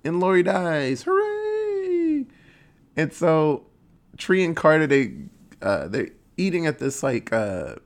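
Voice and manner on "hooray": high sarcastic hooray; mock cheer. Recapping the killer's death, with a high mock cheer. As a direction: squeal it like a cartoon victory.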